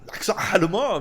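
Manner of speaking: hammed-up German accent, punched out; mock German accent. Shown as a demonstration. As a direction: put on a jokey German accent. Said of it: "tu essaies de faire l'accent allemand".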